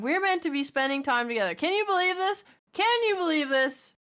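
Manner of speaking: high, rising theatrical indignation; mock outrage. Streamer to chat about partner's work call, mock outrage. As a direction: build to a hammed-up appalled cry.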